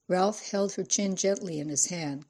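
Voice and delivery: plain reading voice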